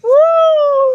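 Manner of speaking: high, loud whoop; whooping cheer. Real, not acted. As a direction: let out a joyful whoop. Cheering at view.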